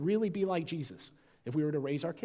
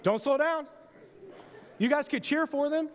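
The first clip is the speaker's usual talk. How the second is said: aerobics instructor; 13 semitones higher than usual. Acted. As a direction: pump them up like a workout instructor.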